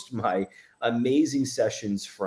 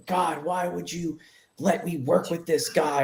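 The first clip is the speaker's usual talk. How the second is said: a mild retelling of being mad at God; 7 semitones higher than usual. Retold or imitated. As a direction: ask God why, frustrated and hurt. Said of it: "I was, like, mad at God".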